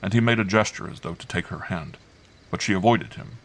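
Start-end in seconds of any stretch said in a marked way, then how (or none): none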